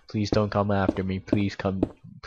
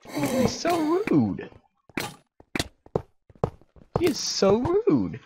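squealed indignation. Real, not acted. Player killed again by rude enemy, high indignant squeal. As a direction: whine it way up high, stretching the words.

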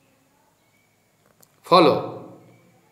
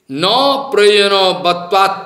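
slow, weighty Sanskrit citation; sutra recitation; 3 dB louder, slower than usual. Acted. Teacher cites a Vedanta sutra to his audience, slow and measured. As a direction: intone the sutra slowly.

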